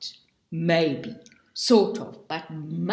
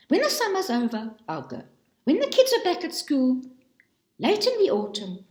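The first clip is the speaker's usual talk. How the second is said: higher, fast, breezy excuse-making voice; mock excuses; 6 semitones higher, faster than usual. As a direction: rattle off hopeful promises, higher and quicker, each one dropping off. Woman voicing her endless excuses.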